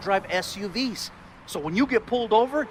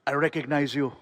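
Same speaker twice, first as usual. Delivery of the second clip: put-on lower, deliberate Austrian-accented recognition; Arnold Schwarzenegger; 4 semitones lower, 3 dB louder than usual. Star recognizes the comedian in line, deliberate Austrian accent. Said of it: "and he's like".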